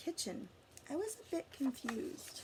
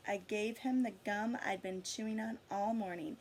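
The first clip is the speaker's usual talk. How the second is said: ordinary delivery, nothing marked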